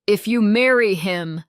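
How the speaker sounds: loud angry father's shout. A narrator's reading. Father forbidding the marriage, shouting. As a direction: bellow it at his daughter. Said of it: "he shouted".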